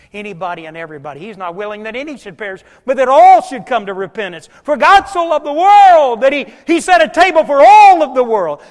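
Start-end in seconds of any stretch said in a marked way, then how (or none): 2.8-8.6 loud, higher rising preacher's climax; preaching at full force. Acted. Preacher proclaiming God's love to the congregation, full force. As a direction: lift the room with it.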